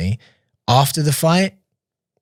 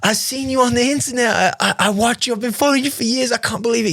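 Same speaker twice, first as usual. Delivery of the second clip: imitating a starstruck Texan, very high-pitched; hammed-up excited Southern fan squeal; freaking-out fan; 14 semitones higher than usual. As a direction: gush in disbelief at meeting your idol. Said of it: "He's freaking out".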